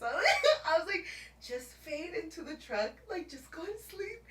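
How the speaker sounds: laughing at her own despair; laugh-crying. Real, not acted. Telling how she gave up in the truck, laugh-crying.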